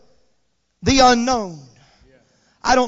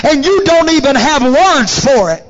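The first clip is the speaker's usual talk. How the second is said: full-force preaching; 3 semitones higher, 7 dB louder than usual. Real, not acted. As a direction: drive it up to a shouted climax. Preaches to a climax.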